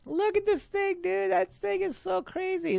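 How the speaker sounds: very high, squeaky falsetto; excited marveling. Pretend. UFO filmer gushes over his favorite sighting, excited falsetto.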